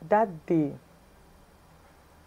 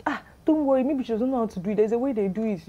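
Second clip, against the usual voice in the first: higher, louder imitation of a caller reassuring; smooth it over, pitched up; acted brush-off; 6 semitones higher, 4 dB louder, faster than usual. Scammer explaining away the refusal, imitated reassurance.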